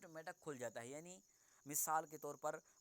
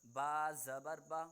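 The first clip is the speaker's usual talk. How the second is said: slow, louder, each syllable clearly set apart; slow teacher's recitation of a Qaida drill; slow model recitation; 5 dB louder, slower than usual. Shown as a demonstration. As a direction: recite slowly for the student to copy. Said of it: "teacher models for repeat".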